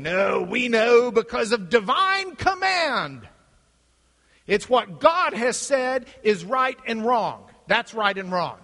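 loud, slow insistence; emphatic objection. Acted. Believer objecting to the philosophers, loud and emphatic. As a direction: proclaim it loudly, stretching the key words.

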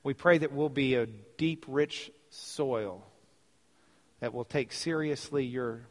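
soft, measured prayer with long pauses; real hushed closing prayer, phrase by phrase. Real, not acted.

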